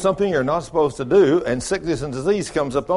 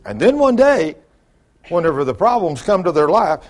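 usual speaking voice

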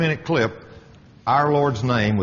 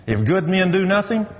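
a raised preaching line; preaching emphasis; 9 semitones higher than usual. Real, not acted. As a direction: lift it like a sermon. Preaching a proverb.

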